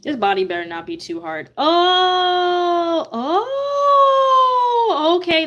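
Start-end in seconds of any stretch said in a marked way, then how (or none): 1.6-5.5 high loud "oh, oh"; startled yelp of surprise; surprised. Real, not acted. Crochet surprise.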